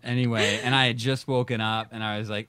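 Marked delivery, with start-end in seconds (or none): none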